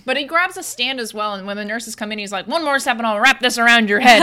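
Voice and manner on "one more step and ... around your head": loud mock-menacing warning; imitated threat. Chiropractor warning the nurses off with a stand, threatening. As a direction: warn them off forcefully, raised voice. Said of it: "he's, like, threatening at them with it".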